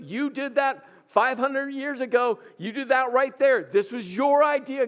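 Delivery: heated rant at God, punching every "your"; indignant, stressing your. Acted. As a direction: pile up the accusations, hitting "your" and "you". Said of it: "[name] just really stressed the word your".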